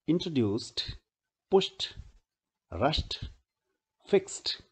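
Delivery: word list from "हश्ड" to "फिक्स्ड", each ending in t. Shown as a demonstration. Teacher reads practice words for students, endings pronounced "t". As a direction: read separately, turning every final -ed into a clean t. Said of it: "क्या उसको आप ट बोलते हैं".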